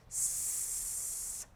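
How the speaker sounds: long hiss; snake hiss. Shown as a demonstration. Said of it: "It says".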